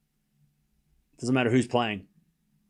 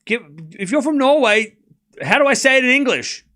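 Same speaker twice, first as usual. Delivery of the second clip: exasperated; 9 semitones higher, 5 dB louder than usual. Real, not acted. Exasperated at being told he is wrong.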